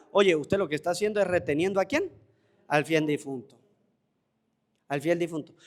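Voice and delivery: fervent preaching, rising and falling; sermon. Acted. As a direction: preach it to the congregation. Priest preaching on mourning.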